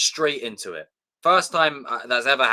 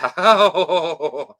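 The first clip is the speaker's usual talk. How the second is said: high, loud drawn-out groaning laugh; reaction "oh-ho-ho"; 6 semitones higher, 8 dB louder than usual. Retold or imitated. Fan hearing Endgame spoiled, long loud "ohh". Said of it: "I was like".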